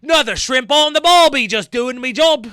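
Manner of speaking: loud, hammed-up Australian accent. Reactor tries mocking the police again, mock Australian accent. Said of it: "How do I do an Australian accent".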